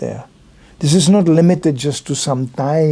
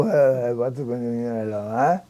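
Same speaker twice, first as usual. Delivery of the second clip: slow, much softer, puzzled "what, hello, huh?"; confused mumble; 5 semitones lower, 7 dB quieter, much slower than usual. Retold or imitated. Passers-by answering him, a slow quiet baffled mumble. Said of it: "they just go".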